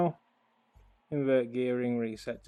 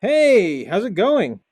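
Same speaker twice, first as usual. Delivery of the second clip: loud, high, cheerful hello; loud greeting; 6 semitones higher, 9 dB louder, slower than usual. Real, not acted. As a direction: call out a bright hello. Greeting a co-player over voice chat.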